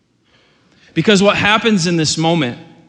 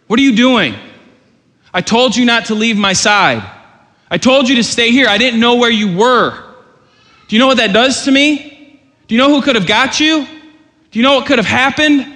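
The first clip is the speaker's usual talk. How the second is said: put-on raised, higher scolding of a lost child; angry parent; 7 semitones higher, 3 dB louder than usual. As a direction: scold the kid who wandered off, loud and high. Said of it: "you're upset and you're angry".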